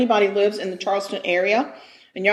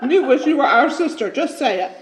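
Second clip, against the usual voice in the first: laughing; 5 semitones higher than usual. Real, not acted. Teasing listeners while laughing.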